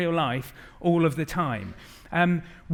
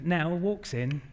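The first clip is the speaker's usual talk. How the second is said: ordinary talk, nothing marked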